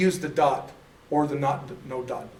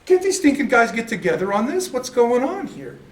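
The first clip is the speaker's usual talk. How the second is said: exasperated; 7 semitones higher, 4 dB louder than usual. Retold or imitated. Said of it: "And I'm like".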